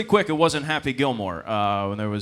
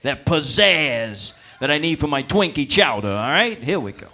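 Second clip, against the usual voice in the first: cocky chef; 2 semitones higher, 7 dB louder than usual. Retold or imitated. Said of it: "He's like".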